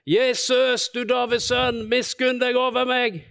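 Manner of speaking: a blind beggar shouting to Jesus for mercy; desperate shout. Retold or imitated. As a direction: call out over a crowd, begging. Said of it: "Han roper for full hals".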